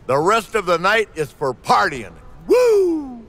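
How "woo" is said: high, whooping signature Woo; Ric Flair woo. Acted. Flair whoops as the crew starts the party, high celebratory woo. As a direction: let out a big celebratory woo.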